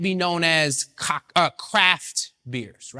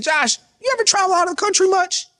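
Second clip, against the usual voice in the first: high teasing question; 12 semitones higher, 3 dB louder, faster than usual. Retold or imitated. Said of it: "I said".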